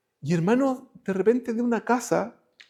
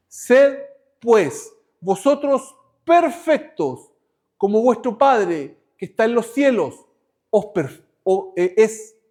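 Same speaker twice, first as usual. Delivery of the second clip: measured, declaiming sermon read; scripture reading; 4 dB louder than usual. Acted. Preacher reads the Gospel aloud to the brothers, declaiming. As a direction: proclaim the verses to the congregation.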